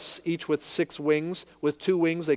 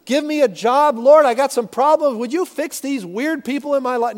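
much higher, louder, whiny begging to God; mock whiny petitioner; 9 semitones higher, 6 dB louder than usual. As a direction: mimic a needy, grabby prayer. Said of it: "your first response is not".

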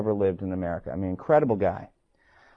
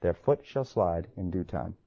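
quiet solemn reading; 6 semitones lower, 8 dB quieter than usual. Shown as a demonstration. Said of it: "That's his text".

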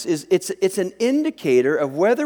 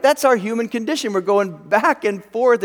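normal talking voice

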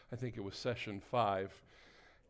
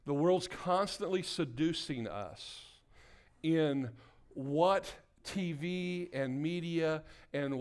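higher, insistent sermon delivery; emphatic preaching; 5 semitones higher, 4 dB louder than usual. Real, not acted. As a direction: preach it with mounting urgency. Preaches with rising intensity.

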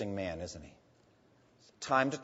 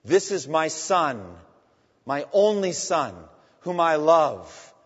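slow, louder, solemn divine voice; God's voice from the cloud, slow and louder; 7 dB louder, slower than usual. Retold or imitated. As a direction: proclaim it slowly from above. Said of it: "a voice came from the cloud".

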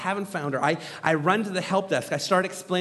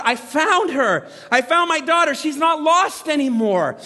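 joyful shout; 6 semitones higher, 5 dB louder than usual. Retold or imitated. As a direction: shout it out with relief. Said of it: "I shout".

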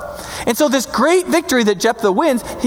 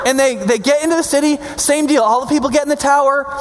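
ordinary talk, nothing marked

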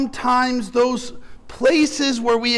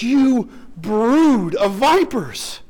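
prophet's rebuke; slower than usual. Retold or imitated. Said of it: "he calls out to them and says".

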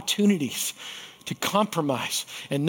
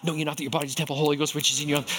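rapid-fire memorized recitation; gabbling a memory verse at top speed; rattled off fast; 3 semitones lower, 4 dB quieter, much faster than usual. Retold or imitated. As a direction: rush through the verse as fast as possible. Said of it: "rip it off as fast as I could".

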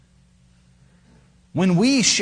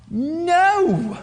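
sharp high emphatic no; 13 semitones higher than usual. Acted. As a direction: shut the question down firmly. Emphatic answer.

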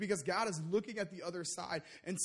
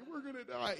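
mock crying; 6 semitones higher than usual. Little brother on the roller coaster, mock crying. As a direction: imitate a bawling little brother. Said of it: "I'm crying. I'm like".

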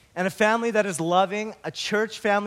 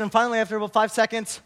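ordinary talk, nothing marked

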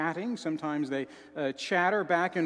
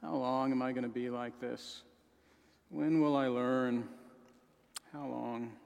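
despondent inner voice; 5 semitones lower, 6 dB quieter than usual. Retold or imitated. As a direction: lower and much softer, sorrowful. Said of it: "a despondent commentary that we speak even to ourselves".

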